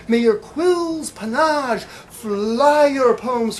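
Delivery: loud, high, building slam-poet shouting; rally the room like a preacher of poetry; rousing declamation. Acted. Poem builds to loud climax.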